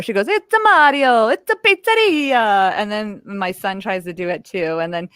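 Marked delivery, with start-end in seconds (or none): 0.3-2.7 high, loud mock Italian Mario; hammed-up Italian plumber voice; Mario impression. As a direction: imitate the daughter's Mario, Italian. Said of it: "she tries to do Mario".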